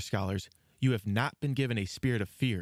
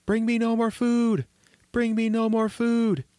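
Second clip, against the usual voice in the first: insistent shout, pitched way up; 10 semitones higher, 4 dB louder than usual. A narrator's reading. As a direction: shout it in a high, panicky little voice. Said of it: "Thumbling began to shout out again".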